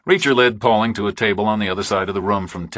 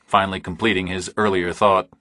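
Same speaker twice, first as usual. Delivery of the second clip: ordinary delivery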